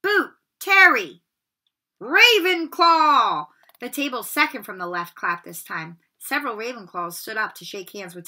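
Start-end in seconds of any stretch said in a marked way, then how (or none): 0.0-1.2 higher, louder, slow name call. Acted. Calling names. 2.0-3.5 higher, louder, slow announcing shout; the hat bellows the house name; hat's shout. Acted.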